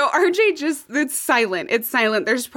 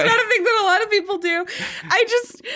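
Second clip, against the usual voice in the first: laughing; 7 semitones higher, faster than usual. Real, not acted. Laughing through denial.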